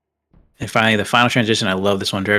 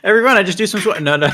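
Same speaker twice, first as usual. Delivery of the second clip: laughing; 10 semitones higher, faster than usual. Real, not acted. Joking about squats on stream.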